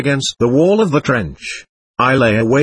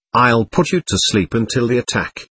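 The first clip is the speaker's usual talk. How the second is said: ordinary delivery, nothing marked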